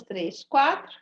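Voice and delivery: ordinary delivery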